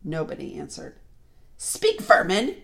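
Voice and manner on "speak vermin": loud, high, slow order; commanding witch. A narrator's reading. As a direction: order them loudly, stretching each word. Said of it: "she said again".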